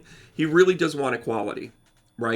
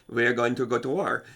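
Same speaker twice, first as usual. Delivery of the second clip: a lightly voiced, understated Putin declaring war; 3 semitones lower, 3 dB quieter than usual. Astrologer voicing Putin's threat to Zelensky, lightly mimicked. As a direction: lower and a touch darker, matter-of-fact. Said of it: "Putin is trying to say".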